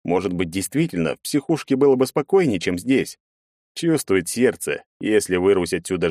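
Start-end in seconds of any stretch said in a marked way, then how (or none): none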